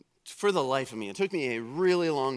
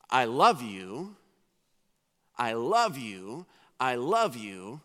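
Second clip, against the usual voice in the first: tender parent; 3 dB louder, slower than usual. Retold or imitated. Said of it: "as a parent to say".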